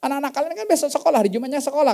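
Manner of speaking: surprised objection. Retold or imitated. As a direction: protest in disbelief. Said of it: "kata delapan belas kan".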